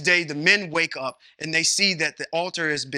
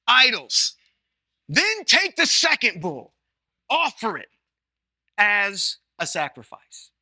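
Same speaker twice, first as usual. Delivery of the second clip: slow solemn command; slower than usual. Acted. Retelling God's command slowly.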